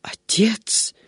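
hushed, whispery gasp of despair; shocked half-whispered cry; despairing exclamation. A narrator's reading. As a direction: breathe the word out in stunned despair. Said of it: "в отчаянии воскликнул монах".

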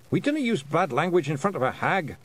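mildly thundered Scottish rebuke; thundering. A narrator's reading. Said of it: "Rob Anybody thundered".

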